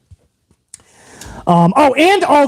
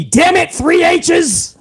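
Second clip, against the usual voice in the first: higher, shouted mock boss; VKM voice; 9 semitones higher, much slower than usual. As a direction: bark it like an angry chairman. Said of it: "I did that in the V K M voice".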